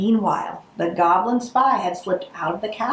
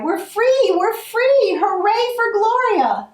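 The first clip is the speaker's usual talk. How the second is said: high, louder cheer of freedom; joyful cry; 13 semitones higher, 5 dB louder than usual. Acted. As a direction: shout it out in joyous relief. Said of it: "witches and goblins cried".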